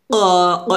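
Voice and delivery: ক as a heavy q from the tongue root; thick QAF sound. Shown as a demonstration. Teacher models the sound of qaf, heavy back ক. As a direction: pop ক far back against the palate. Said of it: "এভাবে একটা শব্দ বের করে".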